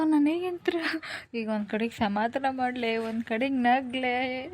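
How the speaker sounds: usual speaking voice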